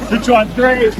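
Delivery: high, loud whoops of joy; excited cheering. Real, not acted. Vlogger whooping with friends at the waterfall, excited. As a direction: cheer it out, thrilled.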